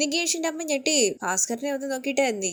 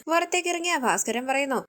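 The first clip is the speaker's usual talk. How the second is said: plain reading voice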